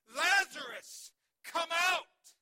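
roaring Lazarus, come out at full volume; commanding shout, slow and huge; loud command. Acted. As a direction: bellow it from the tomb's mouth. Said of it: "Jesus is shouting at the top of his lungs".

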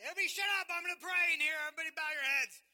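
high, loud pretend bellow at the store; hammed-up command to the whole store; mock shout. Just quietly.

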